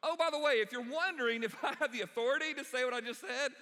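mock-casual challenge with a chuckle; playful Jesus. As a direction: toss it off like a cheeky aside. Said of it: "Jesus is going".

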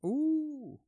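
high, loud teasing ooh; a mock-shocked ooh. Real, not acted.

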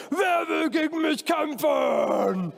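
high, drawn-out giant's bellow; shouted challenge. Acted. Goliath challenging the Israelite army, shouting out. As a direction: call it slowly across a battlefield as a giant. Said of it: "Der kam immer raus und hat gerufen".

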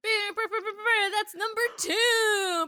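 high, loud laughing fanfare; booming pumped announcer. Pretend. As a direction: trumpet the title, cracking up. Mock fanfare for the title.

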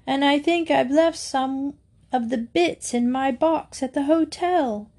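upset. A narrator's reading. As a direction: explain the mishap in mild distress. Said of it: "looking most upset as he shot past".

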